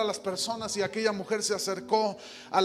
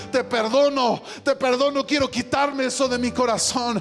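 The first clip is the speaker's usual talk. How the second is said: loud, high, fervent climax of the sermon; preaching at full force; 4 semitones higher, 6 dB louder than usual. Acted. Preacher relating the forgiven woman's joy to the church, fervent and loud. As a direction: build the testimony to a triumphant peak.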